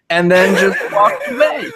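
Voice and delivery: real laughter bursting into the words; laughing. Real, not acted. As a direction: laugh through the end of the story. Laughing while finishing his absurd anecdote.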